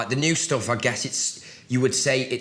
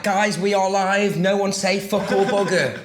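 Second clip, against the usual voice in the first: presenter impression; 8 semitones higher, 3 dB louder than usual. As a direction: warn the crew like a live host. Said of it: "she's like".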